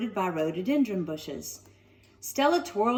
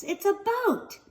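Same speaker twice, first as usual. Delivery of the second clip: disbelief; 12 semitones higher than usual. Acted. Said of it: "she whispered in disbelief".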